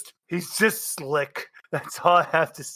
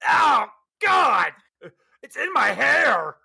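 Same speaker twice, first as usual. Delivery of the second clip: high, shouted goblin outcry; shrieking goblin; 8 semitones higher, slower than usual. Acted. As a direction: cry out as a horrified goblin. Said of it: "and a goblin go".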